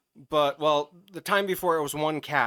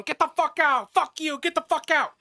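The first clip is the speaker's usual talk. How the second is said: high, loud cursing at the cat; yelling; 13 semitones higher, 4 dB louder than usual. Retold or imitated. Driver yelling at a cat that won't leave, a loud high yell. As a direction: bellow at the stubborn cat to get out. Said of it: "kept on yelling".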